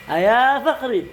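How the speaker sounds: high "Ayah Fakhri" call. Shown as a demonstration.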